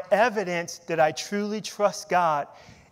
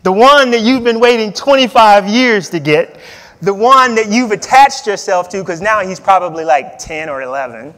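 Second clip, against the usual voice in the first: God's command; 3 semitones higher, 15 dB louder than usual. Retold or imitated. Preacher voicing God telling Abraham to kill his son, loud stern command. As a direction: bellow it like a demanding God, then whine back in protest. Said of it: "And he tells him".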